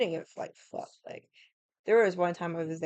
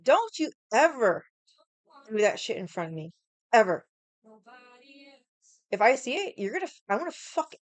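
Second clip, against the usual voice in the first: angry warning; 6 semitones higher, 5 dB louder than usual. Retold or imitated. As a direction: lay down the law, seething. Threatening grandpa after plate.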